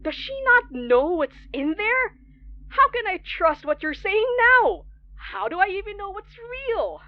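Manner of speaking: high, frantic questions, rushing; near-hysterical disbelief, voice climbing; frightened outburst at the caller; panicked. Acted.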